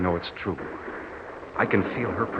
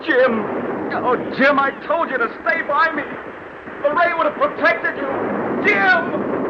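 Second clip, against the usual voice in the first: high, loud desperate calls; anguished calling; 5 dB louder than usual. Acted. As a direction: cry out after a fallen friend. Calling after dying friend.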